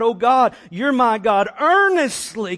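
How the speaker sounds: loud, high cry to God; full-force impassioned psalm reading; desperate intensity. Shown as a demonstration. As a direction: proclaim it with urgent longing. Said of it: "intensity and desperation for God".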